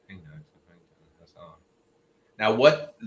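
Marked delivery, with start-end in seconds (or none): 0.1-1.5 very soft, low, quick mumbled imitation; quiet talker. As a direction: imitate a man who barely makes a sound. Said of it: "quiet talker".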